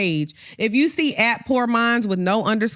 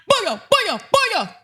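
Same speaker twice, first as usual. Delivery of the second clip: mock hype shout; 8 semitones higher, slower than usual. Mocking silly fake page captions.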